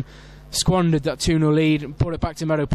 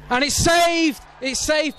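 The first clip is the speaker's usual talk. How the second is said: sudden high-pitched cry of a save; excited commentary; 9 semitones higher, slower than usual. Real, not acted. Commentator calling the penalty save, excited. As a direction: leap up in pitch on the big moment.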